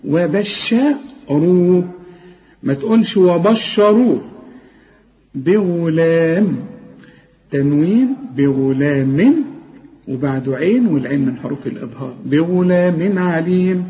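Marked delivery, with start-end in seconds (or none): 0.0-1.9 وبشروه drawn very slowly with a thick ra; very slow heavy ra. Shown as a demonstration. Teacher to student: very slow, heavy وبشروه. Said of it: "قل لي". 12.2-13.8 بغلام عليم slow, the tanween shown clearly before ain; clear nunation. Shown as a demonstration. Quran teacher recites: slow, clear tanween. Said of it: "عشان تقول بغلام".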